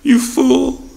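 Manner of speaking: high, scornful crowd jeer; mocking crowd. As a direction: sneer it like the scoffers. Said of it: "they say".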